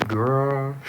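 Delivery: ordinary talk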